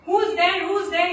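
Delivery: loud, high startled challenge; yelling. Acted. Guru calls out to a dark shadow at night, yelling. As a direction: yell it sharply into the dark. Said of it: "He started yelling".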